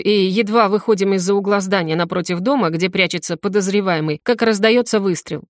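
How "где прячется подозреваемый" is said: plain reading voice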